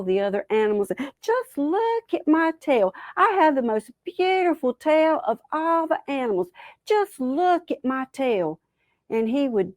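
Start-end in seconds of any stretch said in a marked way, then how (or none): 1.2-6.5 high, vain show-off voice; boastful Possum. Acted. Boastful Possum shows off his tail. 6.8-8.6 high, showy boast; boastful Possum. Acted.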